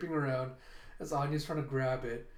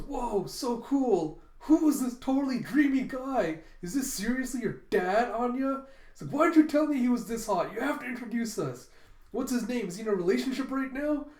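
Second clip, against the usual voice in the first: high, loud fangirl swooning; fanatical gushing; 8 semitones higher, 5 dB louder than usual. Acted. Becky swooning over her friend's dad's picture, fanatical gushing. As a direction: gush breathlessly over a dreamy crush. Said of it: "how fanatical Becky's going".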